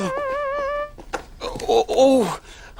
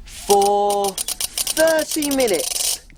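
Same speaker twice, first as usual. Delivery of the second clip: very slow, low and deliberate; slow-motion low delivery; drawn-out; 6 semitones lower, much slower than usual. Acted. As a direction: stretch each word while winding the timer. Puppet slowly setting the timer.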